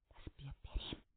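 hushed, unvoiced urging; whisper in ear. A narrator's reading. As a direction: whisper it urgently to her alone. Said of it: "هیرتون زیر گوشش گفت".

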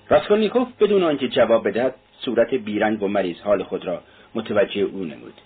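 ordinary delivery, nothing marked

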